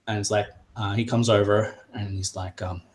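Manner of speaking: ordinary delivery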